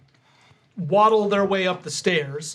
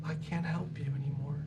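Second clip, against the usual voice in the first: very soft character voice; hushed refusal; 2 semitones lower, 12 dB quieter than usual. Acted. Companion tells the party no more help, hushed and resigned. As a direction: turn them down almost under your breath.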